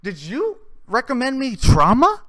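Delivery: loud, high disbelieving "what the fuck"; incredulous confrontation. Retold or imitated. Confronting the coworker at work the next day, loud and incredulous. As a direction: call out your buddy, half laughing in disbelief.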